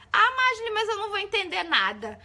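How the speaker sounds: high, loud complaining student; protesting student voice, high and loud; whiny student. Retold or imitated. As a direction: whine the objection like a student.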